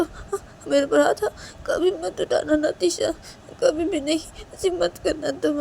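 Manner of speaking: high, whimpering plea; wounded, crying insistence; tearful, hurt Shanaya. Acted. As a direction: beg tearfully for a promise.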